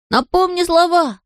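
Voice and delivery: high, drawn-out call. A narrator's reading. Bertel forgot the magic words, calls down to Nisse. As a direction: call it slowly down the stairs. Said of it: "крикнул он вниз малышу".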